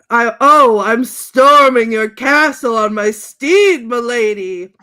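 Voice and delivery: loud, slow, drawn-out heroic cry; hammed-up movie passion; mock-passionate shout. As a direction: bellow it like a charging knight. Acted lovemaking cry, shouted.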